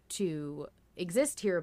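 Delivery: normal talking voice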